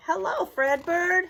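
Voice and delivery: slow, high, loud cheer; cheering fans. Acted. Fans after the seventh inning stretch, loud cheer. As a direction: cheer the greeting after the song. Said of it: "fans cheered".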